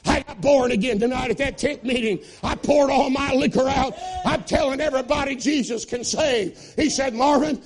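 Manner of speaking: high, loud, fast-rising revival preaching; preaching at full force. Acted. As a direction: shout the testimony to the rafters.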